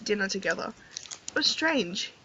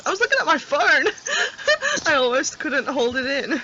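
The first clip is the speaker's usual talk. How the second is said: laughing through words; 4 semitones higher, 3 dB louder than usual. Real, not acted. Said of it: "I'm not laughing".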